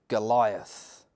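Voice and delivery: usual speaking voice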